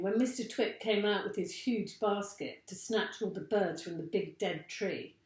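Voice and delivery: ordinary delivery, nothing marked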